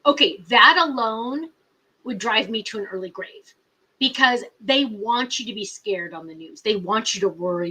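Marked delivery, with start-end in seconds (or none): none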